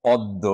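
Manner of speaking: পদ্ম mit doppeltem d, pɔddo; পদ্ম langsam vorsprechen, das d verdoppelt; Lehrer-Aussprache. Shown as a demonstration. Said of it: "আমরা যদি পদ্মর উচ্চারণ করি".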